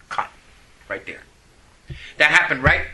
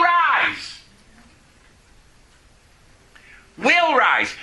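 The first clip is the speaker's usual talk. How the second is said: preacher driving the point to the crowd; preached stress on rise; 7 semitones higher, much slower than usual. Real, not acted. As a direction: rise lifted high then Will rise repeated. Preaching climax.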